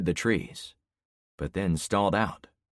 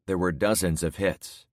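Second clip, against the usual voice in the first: ordinary delivery, nothing marked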